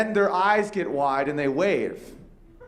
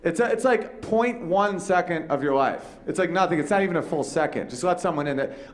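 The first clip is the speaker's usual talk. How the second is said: ordinary talk, nothing marked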